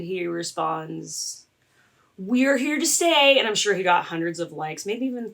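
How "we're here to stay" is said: loud, higher mock proclamation; mocking, raised-voice declaration; defiant retort. Retells pastor's defiant online response.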